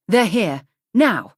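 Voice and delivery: loud, urgent warning that enemies have arrived; urgent alarm. Acted. Crew member warning the captain of boarders, loud alarm. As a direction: raise the alarm, sharp and loud.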